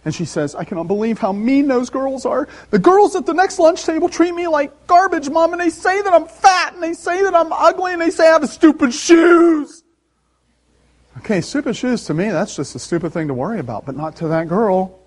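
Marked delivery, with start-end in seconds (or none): 0.5-9.8 very high-pitched wail, building louder; hammed-up distraught daughter complaining to Mom; upset schoolgirl. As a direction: pour out the hurt, climbing to a loud high finish. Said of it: "Your daughter comes home from school".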